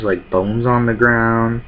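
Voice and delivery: normal talking voice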